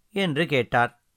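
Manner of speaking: ordinary delivery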